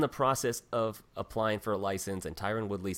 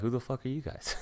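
lightly voiced Irish sneer; Conor impression; 5 semitones higher, 3 dB quieter than usual. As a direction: dismiss them like Conor in a hint of Irish brogue. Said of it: "pull Conor on us and be like".

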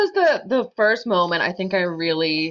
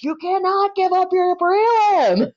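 high, drawn-out mock scream; slow, high-pitched retold yell, each word stretched; 11 semitones higher, slower than usual. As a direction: act out screaming at your client in court. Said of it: "just screaming bloody murder".

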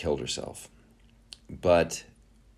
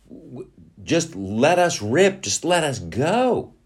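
slow high plea; 8 semitones higher, slower than usual. Acted. America pleading for freedom.

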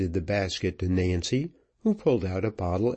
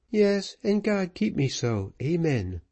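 mournful; 9 semitones higher than usual. Acted. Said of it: "replied Nancy mournfully".

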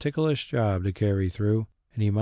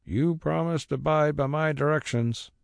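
reproachful; 6 semitones higher than usual. A narrator's reading. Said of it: "the carrier cried reproachfully".

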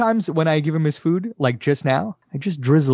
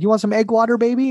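put-on baby talk to dog; 9 semitones higher than usual. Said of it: "I'm like".

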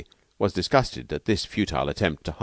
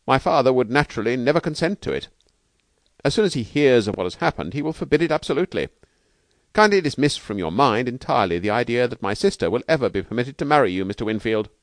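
higher, emphatic objection; indignant Bailey; 6 semitones higher, 4 dB louder than usual. Acted. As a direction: dismiss the suitor firmly and formally. Bailey forbids the marriage.

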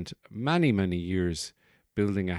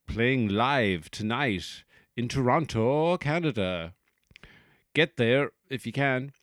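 mock gig announcer; 4 semitones higher, 4 dB louder than usual. Host advertises an imaginary punk gig, hyped announcer voice. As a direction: hype the show like a promoter.

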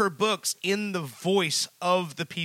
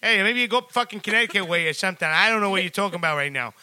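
mock Boston local. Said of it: "they go".

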